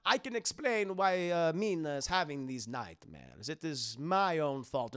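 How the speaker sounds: slow mock-courteous vampire; Dracula. Dracula covers his bases, put-on vampire accent. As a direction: confess like a dignified count. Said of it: "Also, Dracula says".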